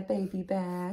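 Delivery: ordinary delivery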